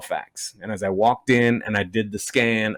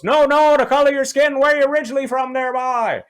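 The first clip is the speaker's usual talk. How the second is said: put-on high Newfoundland accent, pushing the question; drunk Newfie; 13 semitones higher, 4 dB louder than usual. Drunk Newfie pressing the comedian about his origins, high Newfoundland accent. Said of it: "And he goes".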